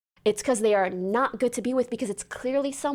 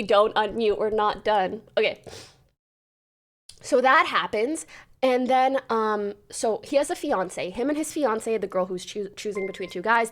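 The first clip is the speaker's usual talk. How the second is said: usual speaking voice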